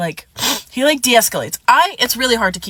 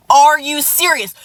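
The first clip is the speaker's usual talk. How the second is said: higher, louder, slow indignant "Are you serious?" with the weight on "Are"; retold outrage; 5 semitones higher, 4 dB louder, slower than usual. Telling off the ex who texted her, louder and slower. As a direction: lean hard on "Are" in disbelief. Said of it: "And so I was like".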